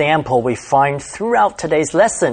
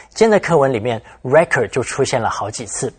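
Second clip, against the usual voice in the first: ordinary talk, nothing marked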